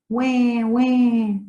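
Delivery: put-on high, drawn-out baby wail; mock baby crying. Teacher acts out the baby that cried, mock crying. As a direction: imitate a baby's "wah, wah". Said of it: "The baby cried".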